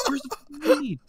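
the frustrated cop's yell straining and cracking; retold cop scream, cracking. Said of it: "and he would start screaming".

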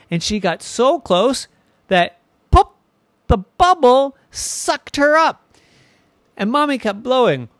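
high, loud build with punched words; dramatic storytelling. A narrator's reading. Narrator telling how the bubble swallows Irene, excited. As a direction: build suspense, then draw out the climax loudly.

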